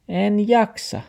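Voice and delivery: high-pitched excuse; taxi character. A narrator's reading. Taxi makes excuses to grandfather, high-pitched. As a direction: wriggle out in a high little voice.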